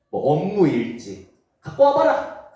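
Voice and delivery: ordinary talk, nothing marked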